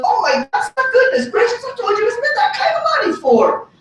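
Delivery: very high, hammed-up scolding; caricatured high-pitched voice of an exasperated woman; imitated scolding friend's mom. Friend's mom scolding him over the suit money, exaggerated imitation.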